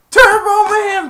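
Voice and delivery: very high, drawn-out mock scream; frenzied yell. Shoppers going wild for Turbo Man, frenzied scream. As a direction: stretch it into a piercing crowd yell. Crowd calling louder, second take.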